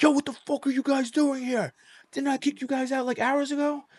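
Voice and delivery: high-pitched indignant confrontation; retold guard's outraged challenge, voice shot way up; acted-out incredulous telling-off; irate security guard. Irate guard confronts the trespassing uncles.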